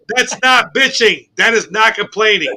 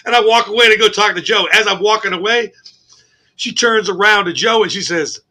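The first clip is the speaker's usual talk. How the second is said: ordinary delivery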